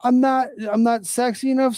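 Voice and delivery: high, indignant mock scream; hammed-up retold screaming. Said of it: "screams the lady".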